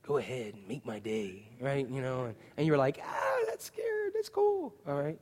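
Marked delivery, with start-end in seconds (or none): 0.0-1.5 low, soft tough-guy growl; a hint of Dirty Harry; lightly voiced Clint Eastwood; Clint Eastwood line. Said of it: "Dirty Harry... he could go". 3.0-4.7 much higher, slow, soft gush; mock-awed fanboy. Fans reacting to Eastwood.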